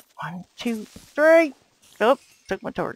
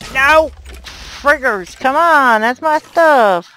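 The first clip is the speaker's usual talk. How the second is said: loud, higher, frantic shouting at the thieves; angry yelling; 7 semitones higher, 12 dB louder, slower than usual. Real, not acted. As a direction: yell to protect your stuff. Mobs stealing his stuff.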